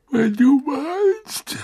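slow, drowsy, high murmur; half asleep, dragging the words; sleepy murmur. A narrator's reading. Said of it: "murmelte sie schläfrig".

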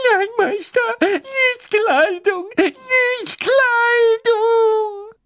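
very high, slow, crying wail. A narrator's reading. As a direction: wail and beg, drawn out. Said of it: "Winky sobbing at his feet".

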